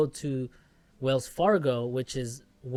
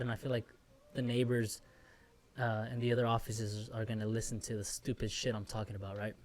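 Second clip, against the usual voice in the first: talking while chewing gum; 3 semitones lower, 7 dB quieter than usual. Real, not acted. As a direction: gum in the mouth. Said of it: "and I'm chewing gum".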